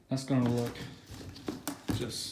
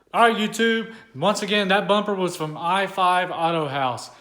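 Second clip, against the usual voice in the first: louder and higher sign-off to YouTube; excited outro; 5 semitones higher, 6 dB louder than usual. Real, not acted. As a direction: call out to the viewers with energy, slowing on the brand name. Calling out to viewers in outro.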